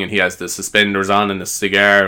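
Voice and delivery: ordinary talk, nothing marked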